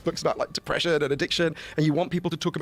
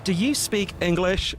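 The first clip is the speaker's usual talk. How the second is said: drawn-out question, pitched up; slow, as to a foreigner; 4 semitones higher, much slower than usual. Retold or imitated. Waitress to a customer she can't understand, slow and careful. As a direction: spell it out slowly for a foreigner.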